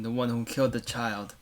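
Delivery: ordinary talk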